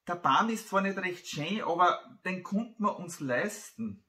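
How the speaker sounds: a hint of a child pleading with Papa; child to father. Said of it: "sagt das Kind".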